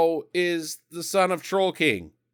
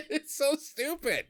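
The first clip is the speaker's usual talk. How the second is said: usual speaking voice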